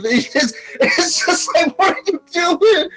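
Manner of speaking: high laughing plea; half-laughing mock outrage; laughing disbelief. Real, not acted. Laughing incredulous quote.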